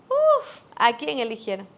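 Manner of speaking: a father mocking his child's news; scoffing father. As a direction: brush the kid's news off with a scoff. Dismissive father answers child.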